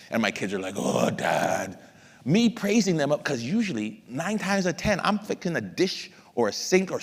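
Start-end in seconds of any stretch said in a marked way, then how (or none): 0.8-1.8 mock groan. Kids reacting to dad's silly voice.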